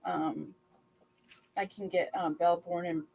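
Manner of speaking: normal talking voice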